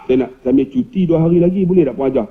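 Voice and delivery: low, quick and polite; meek request. Acted.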